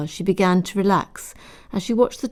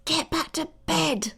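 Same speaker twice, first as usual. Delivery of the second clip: hissed; 11 semitones higher, 5 dB quieter than usual. A narrator's reading. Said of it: "she hissed".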